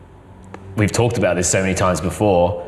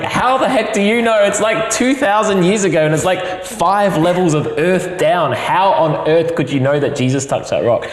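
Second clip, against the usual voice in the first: 1 incredulous; 10 semitones higher than usual. Retold or imitated. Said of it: "And I was like".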